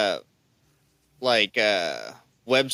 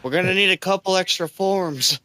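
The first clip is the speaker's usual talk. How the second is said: higher, lightly voiced official being fussy; mock bureaucrat; 8 semitones higher than usual. Cohost joking as the producers, mock bureaucrat voice. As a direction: play a petty clerk asking for paperwork.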